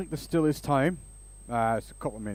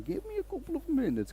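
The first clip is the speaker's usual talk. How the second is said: very high, softer Eastern European tech guy; Eastern European accent; 4 dB quieter than usual. Retold or imitated. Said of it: "I gave him that accent".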